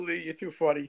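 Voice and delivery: ordinary talk, nothing marked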